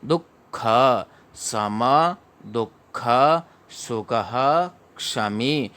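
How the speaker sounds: दुःख slowly as du-kha; teacher recites the phrase slowly; slow recitation. Shown as a demonstration. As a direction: drill सम दुःख सुखः क्षमी slowly. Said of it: "ऐसे पढ़ा जाएगा".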